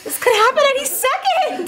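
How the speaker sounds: high, loud squeal of alarm; hammed-up panic, voice shooting way up; panicked freak-out. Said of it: "and I'm like".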